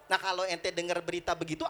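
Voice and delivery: usual speaking voice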